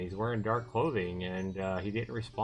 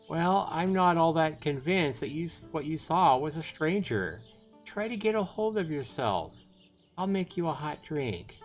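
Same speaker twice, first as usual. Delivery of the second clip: higher-pitched voice for the aunt; sceptical aunt, soothing; 6 semitones higher than usual. Acted. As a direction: play the doubting aunt, pitched up.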